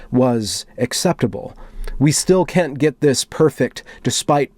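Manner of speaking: slow, solemn manifesto read; dramatic reading. Acted. Reading a moderator's manifesto to viewers, solemn and dramatic. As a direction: read it like a grave confession. Reads the mod's manifesto aloud.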